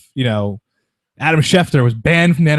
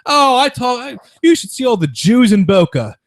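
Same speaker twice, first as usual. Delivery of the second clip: higher, louder airhead chatter; mock clueless reporter; 12 semitones higher, 5 dB louder than usual. Host mimicking the fired reporter's chirpy careless remark. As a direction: parody her careless chirpiness. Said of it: "she goes".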